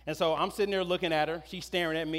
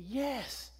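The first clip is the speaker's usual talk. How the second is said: put-on much higher, slow eager child; higher, slow hopeful little-girl voice; daughter; 10 semitones higher, much slower than usual. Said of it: "And she said".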